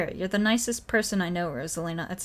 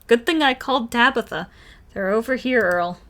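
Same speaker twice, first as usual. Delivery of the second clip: loud, higher call to someone down the hill; loud and higher, calling Earl over; 4 semitones higher, 9 dB louder than usual. Acted. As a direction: shout over so Earl can find them. New character calls out loudly.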